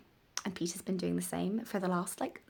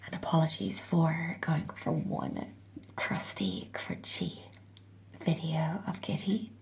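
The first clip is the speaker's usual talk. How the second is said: croaky just-woke-up voice; sleepy rough morning voice. Real, not acted. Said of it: "the morning voice".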